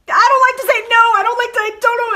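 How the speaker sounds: imitating a crying client who can't say no; tearful whine. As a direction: whimper it like a child close to tears. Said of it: "more tears".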